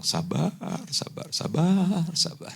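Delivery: slow, drawn-out self-restraint; forced patience. Retold or imitated. Wife telling herself to be patient with late husband, strained restraint. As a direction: stretch it out to hold back irritation.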